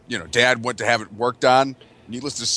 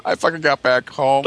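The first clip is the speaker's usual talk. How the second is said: ordinary delivery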